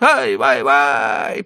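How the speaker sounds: high, loud wailing ай-вай; mock-sorrowful cry of dismay; woeful lament. A narrator's reading. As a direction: grieve out loud like a fretting old trader. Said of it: "горестно потрясая пейсиками".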